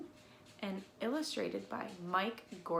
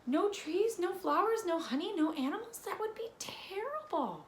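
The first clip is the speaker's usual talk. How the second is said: higher, louder dismayed list; alarmed; 9 semitones higher, 4 dB louder than usual. A narrator's reading. Warning children what nature would lose, alarmed. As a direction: rise in alarm with each loss.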